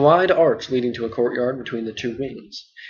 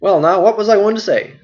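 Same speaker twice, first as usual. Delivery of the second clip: a louder, bustling landlord voice; hearty and full of chatter; talkative innkeeper Butterbur; 6 semitones higher, 6 dB louder than usual. Acted. Butterbur forgetful.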